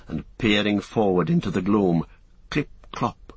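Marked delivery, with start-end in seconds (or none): 2.5-3.3 soft unvoiced clip-clop; whispered hoofbeats. A narrator's reading. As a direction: whisper the hoofbeats like a sound effect. Hoofbeats imitated in narration.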